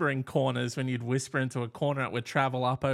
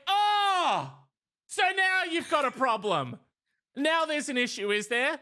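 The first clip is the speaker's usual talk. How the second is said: much higher, mocking indignation; sarcastic retort; 10 semitones higher than usual. Barking man snapping back at the neighbor, mocking and indignant. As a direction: sneer it back like you caught them out.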